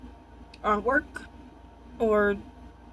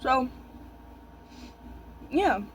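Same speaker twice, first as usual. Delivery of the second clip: ordinary talk, nothing marked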